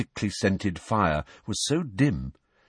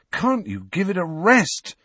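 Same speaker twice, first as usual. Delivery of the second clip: higher exasperated shout at friends; angry outburst; 10 semitones higher than usual. A narrator's reading. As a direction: snap at them, fed up. Harry explodes at bickering friends.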